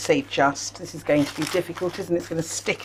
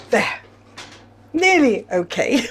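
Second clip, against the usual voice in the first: laughing; 4 semitones higher than usual. Real, not acted. Rescuing a mishap, laughing.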